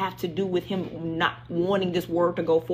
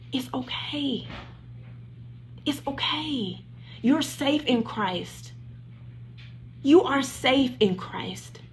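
much softer, slow and tender; soft reassurance; 4 semitones higher, 6 dB quieter, slower than usual. Real, not acted. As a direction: hush them gently, like comforting someone who's crying. Consoling crying viewers.

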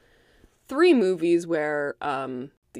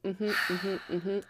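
skeptical mm-hmm; 8 dB quieter, much slower than usual. Retold or imitated. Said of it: "And Ivy's like".

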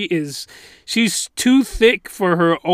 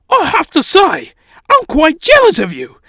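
high, excitable fake British voice; badly faked British, swooping high; terrible British accent; 10 semitones higher, 4 dB louder than usual. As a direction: do a hammed-up British admirer. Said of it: "terrible British accent".

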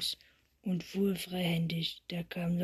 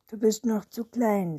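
louder big-brother put-down; raised, emphatic storybook retort; dismissive; 3 semitones higher, 7 dB louder than usual. A narrator's reading. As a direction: read it as a firm put-down. Older boy taunts little Lotta.

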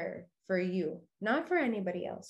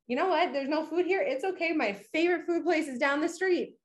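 cheerful self-talk; 8 semitones higher than usual. Retold or imitated.

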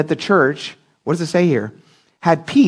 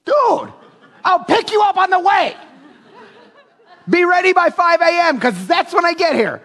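much higher, hyped-up voice of his own offer; eager offer; 13 semitones higher than usual. Retold or imitated. Pastor acts out offering locals a ride, hyped up and much higher. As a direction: pitch it way up like an overeager buddy. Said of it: "I'm like".

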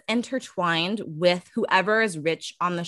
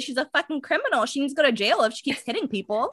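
exasperated rant; 5 semitones higher, much faster than usual. Real, not acted. Ranting at the mom's excuse, fast and heated. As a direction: fire it off in disbelief.

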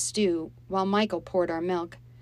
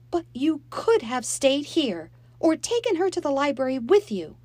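tearful reproach; 9 semitones higher, 6 dB louder than usual. Acted. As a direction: reproach her through tears.

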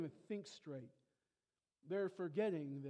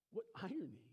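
normal talking voice

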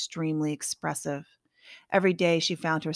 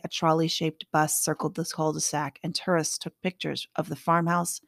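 plain reading voice

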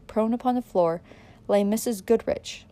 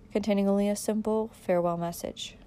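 plain reading voice

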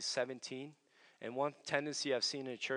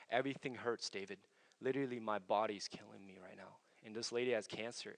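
hushed retelling; 5 semitones lower, 8 dB quieter than usual. Retold or imitated. Crying woman quoted in prayer story.

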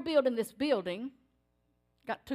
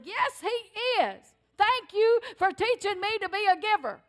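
heartfelt thanks; 8 semitones higher, 5 dB louder than usual. Retold or imitated. Wife thanking husband emotionally.

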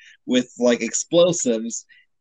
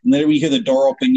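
usual speaking voice

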